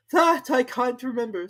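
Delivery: high, loud start; high, loud Hatter; Hatter telling. A narrator's reading. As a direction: carry on nervously. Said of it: "said the Hatter".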